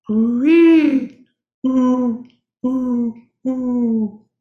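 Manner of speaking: high, loud dove coos; dove cooing. Shown as a demonstration. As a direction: coo like a mourning dove. Imitating doves within the poem.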